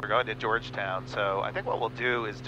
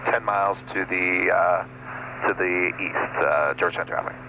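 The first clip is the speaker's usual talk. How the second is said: crisp, louder pilot radio call; 3 semitones lower, 6 dB louder than usual. Real, not acted. Pilot announcing his position to Georgetown traffic, crisp radio call. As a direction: read it out like a pilot on the frequency.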